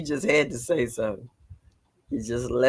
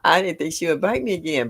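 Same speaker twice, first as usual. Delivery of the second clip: ordinary talk, nothing marked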